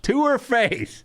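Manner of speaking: high, drawn-out disbelief; incredulous outrage. Real, not acted. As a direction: stretch it out high, appalled. Outraged at the retold remark.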